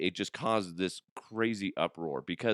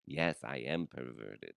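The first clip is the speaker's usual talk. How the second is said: put-on low, soft British accent; Carlos; 8 semitones lower, 7 dB quieter than usual. British character agreeing about himself, low and soft British voice. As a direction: confess it quietly as a creepy Brit. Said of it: "But he's kind of perverted".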